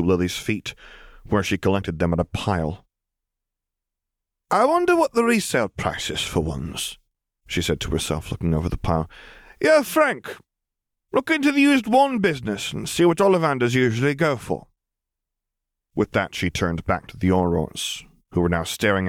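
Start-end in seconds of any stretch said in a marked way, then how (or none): 4.5-7.0 put-on lightly higher, cool sardonic young woman; a lifted, amused female voice; Lily's voice. A narrator's reading. 9.6-14.7 put-on lightly higher, cool sardonic young woman; a lifted, amused female voice; Lily's voice. A narrator's reading.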